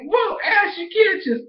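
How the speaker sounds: high-pitched mock outrage; re-enacted disbelieving protest; indignant protest. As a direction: squeak out a scandalized objection. Own protest at Ash, re-enacted.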